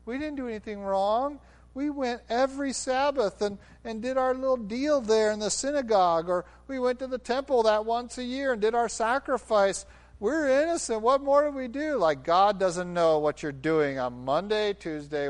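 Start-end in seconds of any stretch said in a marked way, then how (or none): 0.1-12.0 whiny innocent protest. Retold or imitated. Israel protesting to God, mock whiny voice. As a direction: play the indignant self-righteous. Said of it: "Israel was claiming that".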